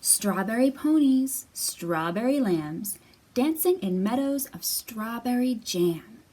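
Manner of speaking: lively sing-song read-aloud; reading to children. Acted. Teacher reading Jamberry aloud to young kids. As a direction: bounce the rhymes for little listeners. Reads a picture book aloud.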